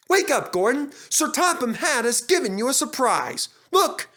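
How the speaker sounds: high, loud, eager calling; excited. A narrator's reading. Driver waking Gordon with a surprise, high excited calls. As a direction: burst in with thrilling news. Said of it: "came running excitedly".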